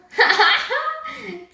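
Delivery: real high-pitched giggling burst; high laugh. Real, not acted. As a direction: crack up at your own silliness. Cracks up at own silly voice.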